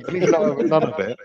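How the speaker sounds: usual speaking voice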